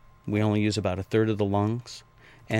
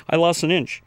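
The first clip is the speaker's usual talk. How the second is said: high and a bit louder, a light mock-thrilled report; excited client; 8 semitones higher, 6 dB louder than usual. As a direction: imitate a delighted client's news. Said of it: "women are coming back in a week and going".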